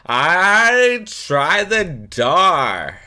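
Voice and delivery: high, loud, very slow eye-rolling shout; the girl shouts impatiently in the Fran voice; Fran-style girl voice, shouting. Acted. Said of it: "rolls her eyes and shouts".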